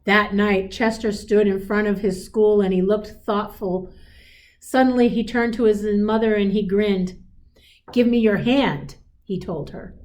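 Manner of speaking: gentle storybook read-aloud; a teacher reading a picture book aloud; reading to children. A narrator's reading. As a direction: read it like a bedtime story to a class.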